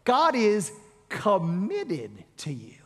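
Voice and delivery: God is committed to you, drawn out slowly with GOD leaned on; slow it right down and lean on GOD; unhurried emphatic take with a lift at the end; slow, weighty restatement. Shown as a demonstration. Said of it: "let me say it this way".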